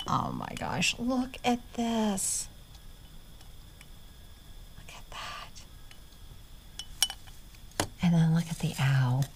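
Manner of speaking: very soft, hushed wonder; almost silent admiration; awed whisper. Real, not acted. Showing viewers the owl ornament, in an awed whisper.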